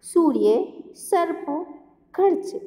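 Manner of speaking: सूर्य, सर्प, खर्च read out clearly one by one; teacher reading reph words to class; reading words aloud. Shown as a demonstration. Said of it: "जिन्हें हम पढ़ते हैं".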